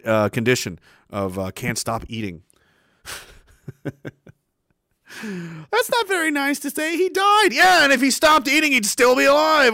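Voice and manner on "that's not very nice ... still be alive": squeaky, far higher put-on voice; high-pitched mock protest. Host objects to his own eating joke, squeaky mock protest. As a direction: jump way up, building into mock indignation.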